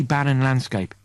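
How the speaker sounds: usual speaking voice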